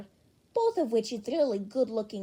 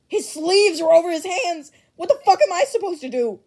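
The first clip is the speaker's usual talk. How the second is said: loud, higher, half-laughing rant; flustered shout; 5 semitones higher, 12 dB louder than usual. Acted. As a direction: yell it in helpless fluster, fast at the end. Bakugo flustered, shouting in chat.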